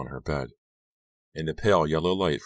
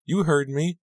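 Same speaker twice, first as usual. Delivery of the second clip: tearful; 12 semitones higher, 4 dB louder, slower than usual. Acted. Crying girl snaps back.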